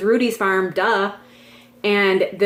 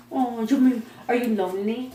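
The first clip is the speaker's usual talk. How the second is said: talking to the dog; 2 semitones higher than usual. Real, not acted. Baby talk to dog.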